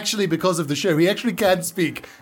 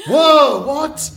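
mock surprise; 6 semitones higher, 6 dB louder than usual. Learns he is a ghost.

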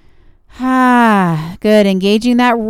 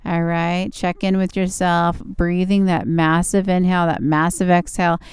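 calm and soothing, easing into rest; 3 semitones lower than usual. Acted. Yoga relaxation cool-down.

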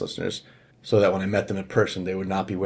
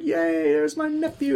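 excited greeting; slower than usual. Retold or imitated. Uncle greeting nephew in story.

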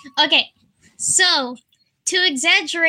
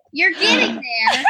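encouraging shout; 8 semitones higher than usual. Real, not acted.

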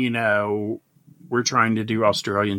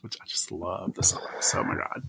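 softer whispered aside; 5 semitones lower, 7 dB quieter than usual. Real, not acted. Host whispering fondly about the socks. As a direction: whisper it like a secret.

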